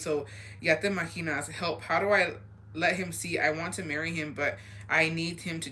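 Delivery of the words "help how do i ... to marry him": ordinary delivery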